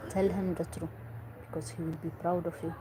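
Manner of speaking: normal talking voice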